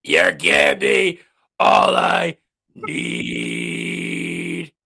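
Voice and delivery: low, slow, croaking shout; hoarse singer parody. Pretend. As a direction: belt it like a wrecked rock singer.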